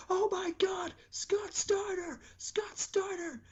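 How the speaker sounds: very high, slow falsetto crowd cheer; excited crowd. Retold or imitated. Crowd reacting to the surprise entrance, a high slow squeal. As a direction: squeal it like a stunned fan. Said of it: "the crowd's like".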